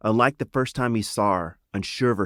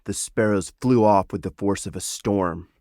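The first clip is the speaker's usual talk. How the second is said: ordinary delivery, nothing marked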